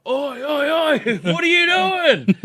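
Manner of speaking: high, loud yelled "oi"s, drawn out; alarmed shout. Retold or imitated. Yelling at a sleepwalking mate pissing by the TV, alarmed shout. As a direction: yell out to stop him mid-act.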